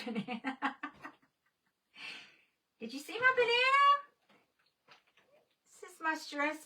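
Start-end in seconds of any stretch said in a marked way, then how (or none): 2.8-3.8 high, fast, eager question; excited call. Real, not acted. Calling to Carol across the stream, high and fast. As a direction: call out to her friend excitedly.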